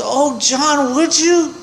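put-on squeaky high, thrilled little-old-lady voice; delighted old mom. Son offers to cut her toenails, she squeals high with delight. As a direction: play his tiny mother, tickled pink at the offer. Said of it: "She goes".

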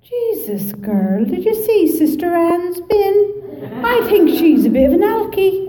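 high, lilting Cork gossip; Cork accent. Retold or imitated. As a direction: imitate sing-song Cork girls gossiping. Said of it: "they'd talk like this".

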